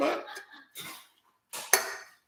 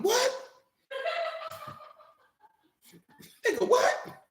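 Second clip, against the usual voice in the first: higher, mocking "what?" challenge; mock high-pitched snap; 7 dB quieter, much slower than usual. Imitating a rough woman cussing a man out, high and mocking.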